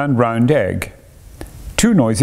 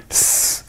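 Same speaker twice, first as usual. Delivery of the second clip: soft, voiceless hissed "s"; snake hiss; 5 dB quieter than usual. Acted. Showing Taffy the plain snake's noise, hissing. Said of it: "that the plain snake only hisses".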